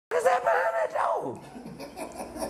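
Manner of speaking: high put-on character voice; high, comic put-on voice; putting on a voice. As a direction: slip into a squeaky goofy voice. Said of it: "I be in the voice".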